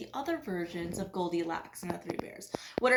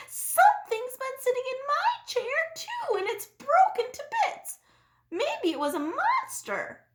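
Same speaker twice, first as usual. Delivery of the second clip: high, small cub voice; little bear voice; 4 dB louder than usual. A narrator's reading. Little bear reports his broken chair, high cub voice. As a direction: talk like a tiny wide-eyed cub. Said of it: "Little Wee Bear's eyes grew very big".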